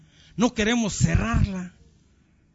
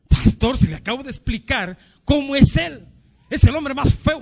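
high, louder mock outrage; indignant protest; 5 semitones higher, 3 dB louder than usual. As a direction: play the exasperated wife, pitched up. Woman protests at advice to forgive.

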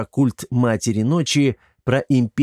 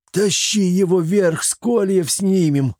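urgent order; 8 semitones higher than usual. A narrator's reading. Chermes orders Kosoy to lift the vampire, urgent order. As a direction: bark it at the bandit mid-strain. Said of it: "приказал Чермес".

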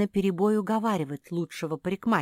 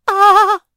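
falling scream; 18 dB louder than usual. A narrator's reading. Марсия falling from the tree, long scream. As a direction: scream as you fall.

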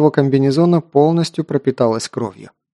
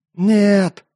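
put-on high frantic cry of the old man; feral wasteland raider; 7 semitones higher than usual. A narrator's reading. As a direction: cry out in desperate frenzy. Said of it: "иступлённо хрипел Моро".

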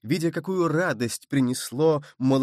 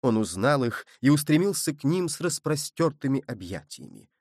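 usual speaking voice

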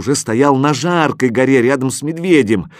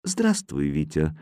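hushed, unhurried hello; mysterious greeting; 7 semitones lower, 7 dB quieter than usual. A narrator's reading. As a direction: greet quietly, sinking to a low voice. Mysterious figure greets the boy.

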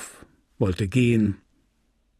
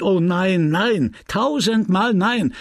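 emphatic refusal; 7 semitones higher, 5 dB louder, slower than usual. Acted. Rodolphe talking himself out of elopement.

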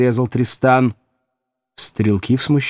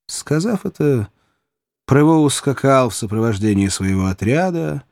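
plain reading voice

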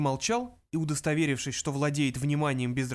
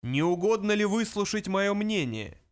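higher, projected request; resounding interjection, pitched up; booming voice; 6 semitones higher, 4 dB louder than usual. A narrator's reading. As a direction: boom it across the room. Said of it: "комнату сотряс зычный голос".

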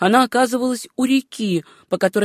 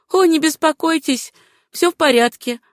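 higher-pitched woman's character voice; reassuring heroine; 7 semitones higher than usual. Acted. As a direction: lift the pitch to brush off his concern. Susanna reassures the doctor.

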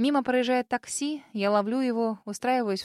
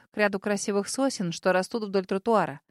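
ordinary delivery, nothing marked

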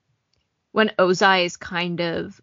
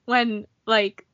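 ordinary talk

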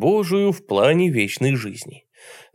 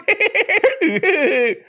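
gleeful cackle; 4 dB louder than usual. Acted. Deacon cackles after his shout.

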